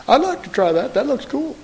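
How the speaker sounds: high, quick, carefree mock-excited; flippant thrill-seeker. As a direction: toss it off like a kid wanting a ride. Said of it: "He didn't say".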